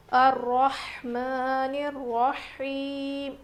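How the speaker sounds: slow, drawn-out recitation voice; tajweed recitation. Shown as a demonstration. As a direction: recite it as a model for students. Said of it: "یہ بڑی ہا ہے". (تلاوت کے انداز میں آہستہ پڑھنا)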